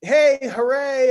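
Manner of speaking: high, drawn-out mock hooray; happy cheering. Retelling everyone getting their seat, happy cheering. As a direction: imitate a group cheer, loud and high. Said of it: "everyone's all happy".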